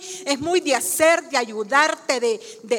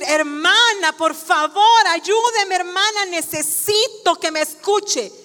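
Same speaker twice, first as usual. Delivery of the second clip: acted desperate appeal, rising then easing; urgent pleading; 3 semitones higher than usual. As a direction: beg insistently to be heard. Member begs again as preacher walks away.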